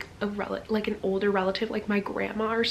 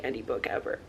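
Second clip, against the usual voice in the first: ordinary talk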